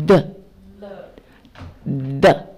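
"的" as English "the", tongue between teeth; isolated demonstration "的" with bitten tongue; TH, not L. Shown as a demonstration. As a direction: model "的" as a voiced TH, never an L. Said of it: "不是了哦".

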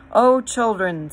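loud joyful call; exuberant lion. A narrator's reading. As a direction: strength flooding back. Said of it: "said the lion".

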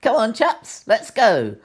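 rally the others, bright and bold; brave little bear. Acted. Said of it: "the bravest one says".

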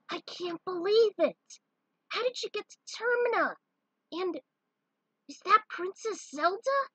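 put-on high, loud boyish shout; a startled kid blurting out his surprise; boy hero amazed to meet his grown-up self; excited kid voice. Kid marvels at visitors.

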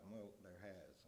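well, there has, with a falling, final tune and no question; said as a statement. Real, not acted. Preacher confessing to his listeners, a falling statement not a question. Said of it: "I'm not gonna say that as a question".

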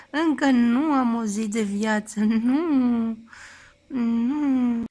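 loud, sing-song cooing to a newborn. Real, not acted. New mother cooing loudly to her hiccuping newborn.

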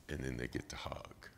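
ordinary delivery

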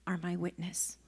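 hushed breathy whisper of are my witness. Shown as a demonstration. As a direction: breathe the words, no voice. Preacher repeats the line softly.

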